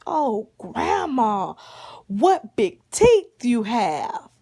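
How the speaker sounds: loud, slow, drawn-out storybook marvel; hammed-up child's amazement. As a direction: stretch each exclamation in big swooping wonder.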